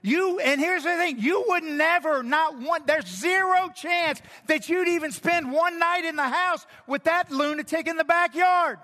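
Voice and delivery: loud, high, driving sermon delivery; full-force preaching. Acted. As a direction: preach it hard at the crowd.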